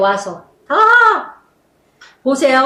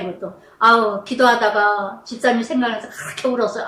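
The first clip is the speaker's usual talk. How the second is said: ordinary talk, nothing marked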